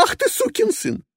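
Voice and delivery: very high, loud outraged curse; furious shriek. Acted. Passenger cursing Petrov for doubting she is Russian, very high shriek. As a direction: explode at him. Ivanova insulted, swears.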